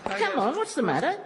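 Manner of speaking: high calls of giddy up. Acted. Devil urging his rider's jockey, high call. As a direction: urge the jockey on like a racehorse.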